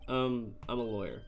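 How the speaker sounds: normal talking voice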